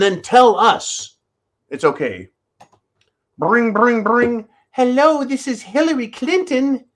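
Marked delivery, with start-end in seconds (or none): 3.4-4.5 phone ring imitation. 4.7-6.8 high voice, a poor imitation; mock politician hello, pitched up; Hillary Clinton impression. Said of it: "No, that's not her voice".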